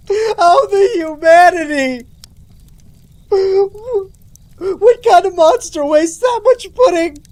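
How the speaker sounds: high, loud, drawn-out fake wailing; mock anguish. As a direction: wail it slowly in hammed-up grief. Mock grief over spilled pudding.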